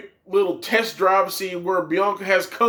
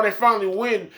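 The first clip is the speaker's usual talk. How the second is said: ordinary delivery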